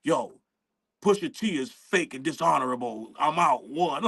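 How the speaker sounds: low, deadpan radio call-out; mock a solemn announcement, pitched well down; low-voiced mock declaration with weighty pauses; Consequence. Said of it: "he goes to the radio, start talking about".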